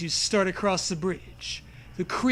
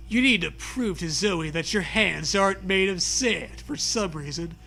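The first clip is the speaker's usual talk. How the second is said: ordinary talk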